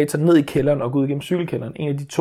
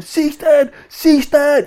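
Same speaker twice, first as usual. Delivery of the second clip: high, loud shout re-enacting panic; retold scream; 13 semitones higher, 7 dB louder, much slower than usual. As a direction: call out desperately after a runaway child, acted. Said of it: "Så skriger jeg bare". (genfortalt panisk skrig)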